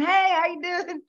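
high, bright hello while waving; cheery greeting. Retold or imitated. Waving back to a friend, high cheery greeting. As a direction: wave and call out a hello. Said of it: "I'm waving at this person".

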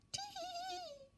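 a faint giggle drifting from far off; soft child's giggle. Acted. Narrator voicing a distant giggling child, high soft tee-hee. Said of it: "a child was giggling".

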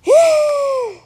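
sharp high loud gasp of surprise; startled squealing gasp. Real, not acted. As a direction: gasp as if shocked. Sudden surprise.

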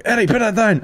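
put-on high, urgent call to a toddler; alarmed parent call. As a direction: snap out the order in a fright.

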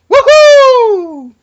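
high, loud whoop of joy; hammed-up woohoo like a game show winner; mock cheer. Cheering at winning prize.

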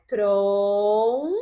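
"Trom" long, loud and low as a lone syllable; sílaba separada. Shown as a demonstration. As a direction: hold "Trom" out firmly, apart from the rest. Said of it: "Vamos separar?". ("Trom" como sílaba isolada, forte e alongada)

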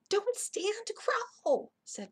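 high, tense urgent order; urgent warning. A narrator's reading. Jack stopping Annie standing up, urgent warning.

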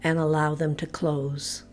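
calm, measured meditation voice with long pauses; low-key, evenly paced body-scan narration; guided relaxation. Real, not acted. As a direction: guide them gently into letting go, phrase by phrase. Leads progressive relaxation meditation.

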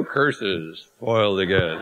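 low, muttering melodrama villain; mustache twirling villain. Pretend. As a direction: grumble like a foiled cad. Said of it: "Kinda looks like the bass singer's mustache".

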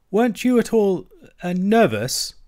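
high, hesitant timid question; remembered terror. A narrator's reading. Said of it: "some of yesterday's terror coming back to him".

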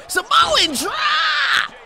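very high, slow drawn-out yell of Samoan drop; stretched-out shout. Real, not acted. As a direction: howl the move name like an announcer. Hits the finisher, yells its name.

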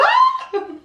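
high shrieking laugh; frightened shriek. Real, not acted. Imagining the mother's reaction.

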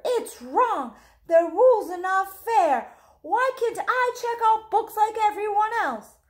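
put-on upset child demanding fairness; indignant boy. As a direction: cry out against the unfairness like a small boy. Boy protests unfair rules.

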